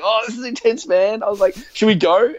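high-pitched tense retelling; high, urgent replay of the moment; retold nervous excitement. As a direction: relive the panic, half enjoying it. Retold tense hiding from enemies.

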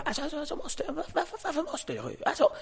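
very high, soft whining voice; hammed-up high-pitched whimpering complaint; whiny Batra. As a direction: stammer it out like an upset colleague. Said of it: "Batra kommer ut och bara".

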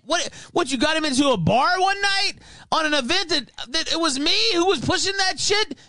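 high indignant mock rant; mock Gator rant. Mimicking Gator's rant at the old man, high and indignant. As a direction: whine it high like an offended blowhard.

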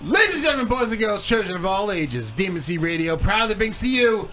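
booming circus ringmaster announcement. Acted. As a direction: roll it out like a big top showman. Opening the show like a circus.

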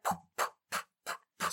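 soft, voiceless puffs in steady beat; quiet rhythmic stomps made with breath; imitated marching sound. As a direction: puff out marching steps, evenly spaced. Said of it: "like, this, like, marching sound".

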